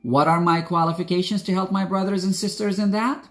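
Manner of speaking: ordinary delivery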